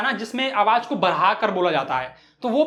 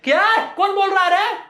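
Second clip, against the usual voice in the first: put-on high, raised old vendor's yell; acted indignant telling-off; shouting across town; 5 semitones higher than usual. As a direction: holler as if across the city. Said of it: "side से दूसरे side की ओर चिल्ला के बोल रही है".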